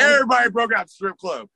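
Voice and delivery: high, emphatic retelling; emphatic repeat. Real, not acted. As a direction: drive home how everybody bolted. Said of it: "when I say, like, everybody broke out".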